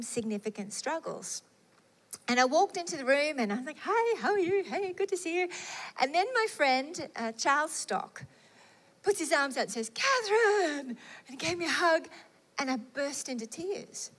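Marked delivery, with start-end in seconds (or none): 3.9-5.5 bright put-on cheer, higher; cheery mask over a struggle; forced cheerful greeting. Faking cheer while struggling. 9.9-10.9 higher, delighted call of her name; warm glad greeting. Retold or imitated. Friend greets her warmly.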